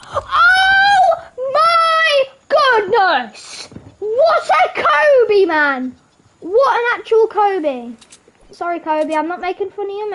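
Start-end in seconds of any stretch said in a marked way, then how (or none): 0.1-7.9 high, loud, very drawn-out cries; amazed screaming. Real, not acted. Gamer amazed by a Kobe throw, excited screaming. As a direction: scream it slowly in awe.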